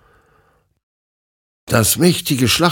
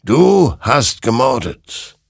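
slow, loud, raised condemnation; accusing creature; 6 semitones higher, 6 dB louder, slower than usual. Acted. Creature accusing Hector, slow and condemning. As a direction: pronounce the verdict, drawing out the words.

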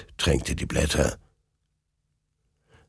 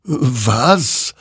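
higher-pitched puzzled question; astonished; 7 semitones higher, 3 dB louder than usual. A narrator's reading. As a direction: ask it in startled wonder. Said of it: "wunderte sich dieser". (hoch und verwundert)

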